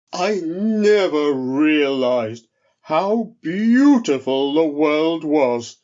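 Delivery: slow, loud, wondering bear voice; a big bear marvelling at the view; awed bear. Acted. The bear gazes from the mountaintop, slow and amazed.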